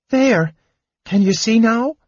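very high, worried kender voice; anxious. A narrator's reading. Kender wiping wounded knight's eyes, anxious. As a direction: ask anxiously in a high little voice. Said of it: "he asked anxiously".

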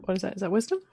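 normal talking voice

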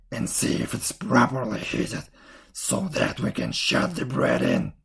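low, put-on old woman's voice; commanding witch. A narrator's reading. Witch readying the oven before Gretel, low and commanding. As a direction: give orders as the wicked old witch.

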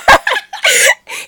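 shrieking burst of laughter; can't contain the giggle fit; loud high cackle. Real, not acted. Recapping the show to a friend, loud shrieking laugh. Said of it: "He literally kicks him out".

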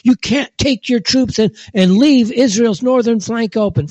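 high, alarmed mock senator; mocking Mitch McConnell. Mocking the senate leader pleading with the president, high and alarmed. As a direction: ham up a panicked politician pleading. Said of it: "he's like".

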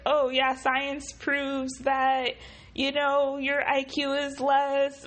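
mocking imitation. Mimicking people who cite science.

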